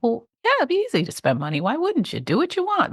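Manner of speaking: high, quick, carefree inner voice; breezy naive voice. Retold or imitated.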